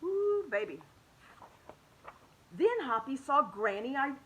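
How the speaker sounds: plain reading voice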